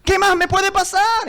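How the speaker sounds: high-pitched exasperated wail; retold cry of a man at the end of his rope; desperate outburst. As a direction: plead upward in disbelief. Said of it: "Y comencé a llorar".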